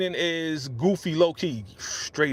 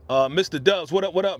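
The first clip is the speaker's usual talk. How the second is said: ordinary talk, nothing marked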